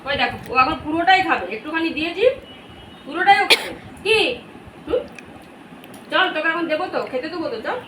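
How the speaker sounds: high playful pet voice; talking to the dog. Real, not acted. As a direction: tease the puppy fondly. Teasing dog about sweets.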